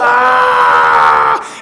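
long, loud imitated scream; mock baby scream. Dad imitating his daughter dangled off the bed, long loud mock scream. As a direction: mimic a baby's sudden screaming outburst. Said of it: "she's yelling at me".

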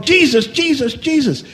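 put-on high excited child calling out. Boy running down the aisle calling to Jesus, high and excited. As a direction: call his name down the aisle with joy. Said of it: "he ran down the aisle calling".

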